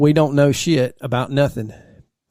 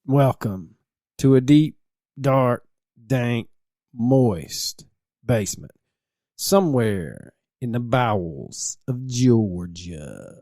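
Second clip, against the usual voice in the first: slow, dramatic, hushed host voice; spooky show intro; 3 semitones lower, slower than usual. Acted. Host opening the true crime podcast, slow and spooky. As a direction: lead the listeners into a creepy basement, dragging each word out.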